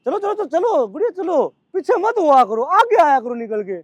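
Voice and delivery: high, eager calls to keep going; urging cheer. Real, not acted. Coach cheering the girls through the jumps, high urging calls.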